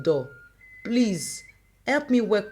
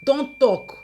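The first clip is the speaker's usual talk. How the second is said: higher, louder sharp command; shouting; 6 semitones higher, 6 dB louder than usual. Acted. Mother shouting down her rival, loud and high. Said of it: "Amara shouted back again".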